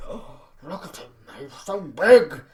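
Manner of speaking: gasp, then a slow, loud awed "so big"; awestruck boss. Retold or imitated. Mocking the bosses fawning over Jinder, gasping and awed. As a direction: gush over the big guy. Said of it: "they just gave this to him 'cause, like".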